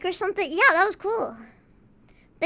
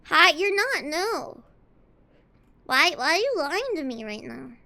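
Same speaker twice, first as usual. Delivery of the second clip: ordinary talk, nothing marked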